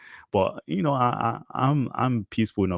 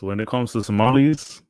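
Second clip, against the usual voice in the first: ordinary delivery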